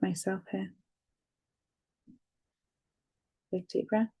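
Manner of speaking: steady, soothing guide through each tapping point; guided tapping session. Acted. Therapist guiding a listener through tapping, calmly.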